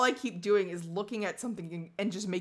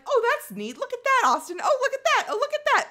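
higher and higher fake delight; mock cheerful; 13 semitones higher, 5 dB louder, faster than usual. Pointing things out to scared Austin in mock cheer. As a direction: chirp it like a tour guide to stay calm. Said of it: "And I'm like".

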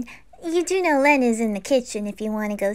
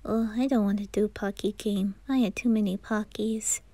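whispered little aside; quiet sheepish whisper; soft whisper; 5 semitones lower, 5 dB quieter than usual. Acted. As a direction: whisper it bashfully. Shy aside about snacks.

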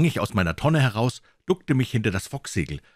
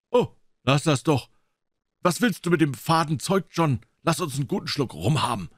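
higher, whiny sailor voice; a higher rough shipmate; Israel's voice; 7 semitones higher than usual. Acted. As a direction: play the grumbling mate, pitched up.

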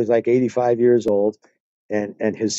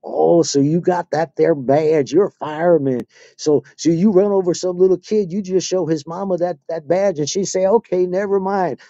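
put-on Southern accent; 6 semitones higher than usual. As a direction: needle the speeding fireman in a put-on Southern accent. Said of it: "I would put on my Southern accent".

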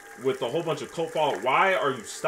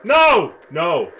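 screaming in high panic; panicking gamer; 11 dB louder than usual. Real, not acted. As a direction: shriek for help, very high and loud. Chased in horror game.

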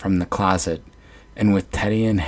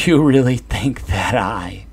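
chuckling; 4 semitones higher, 5 dB louder than usual. Acted. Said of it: "between bouts of compulsive chuckling".

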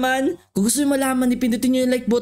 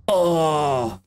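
much lower, big voice; deep villager voice; 11 semitones lower, slower than usual. Acted. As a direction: speak as a tiny villager with a booming low voice.

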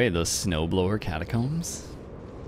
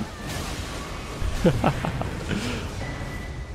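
breathy laugh breaking into high notes; long real laugh; 11 semitones higher, 4 dB quieter than usual. Real, not acted. Player amused that the host died, long laugh. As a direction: laugh helplessly and let it run.

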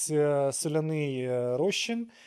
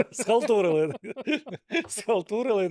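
laughing; 7 semitones higher, 5 dB louder than usual. Real, not acted. Laughing while saying word.

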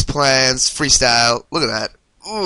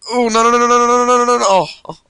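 high, loud rapid-fire stream of no's; panicked; 11 semitones higher, 4 dB louder, much faster than usual. Real, not acted. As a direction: yelp in panic as disaster hits. Character is being mangled in game.

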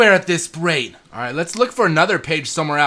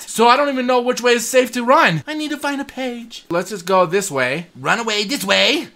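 normal talking voice

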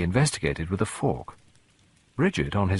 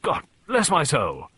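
slow, higher blustering amazement; flabbergasted old colonel; startled exclamation; 7 semitones higher, slower than usual. A narrator's reading. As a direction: exclaim it word by word, astounded. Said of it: "he ejaculated".

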